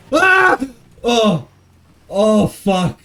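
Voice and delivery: sudden high yelp of fright, then shaky gasps; startled yelp. Real, not acted. Streamer nearly hits something, yelps in real shock. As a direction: jolt up in pitch like you nearly crashed. Near crash on the bike.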